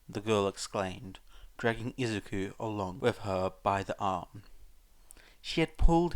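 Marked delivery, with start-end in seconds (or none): none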